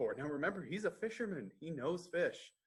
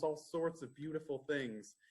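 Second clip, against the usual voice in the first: plain reading voice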